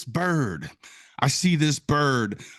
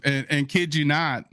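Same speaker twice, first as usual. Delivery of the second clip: ordinary talk, nothing marked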